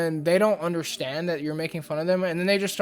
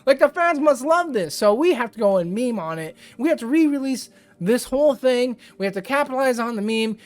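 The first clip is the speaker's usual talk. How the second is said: high, louder hammed-up exec, laughing through it; mock excited studio exec; 6 semitones higher, 4 dB louder than usual. Vlogger parodies Sony execs misreading the memes, high and hammed-up. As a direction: gush like a clueless exec who thinks the memes are praise.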